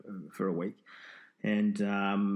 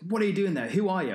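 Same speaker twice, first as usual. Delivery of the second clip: retold demand; 8 semitones higher, 5 dB louder than usual. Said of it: "And he goes".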